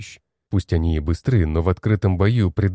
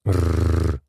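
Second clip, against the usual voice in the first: wolf growl; 2 semitones lower than usual. Acted. Wolf growls before running.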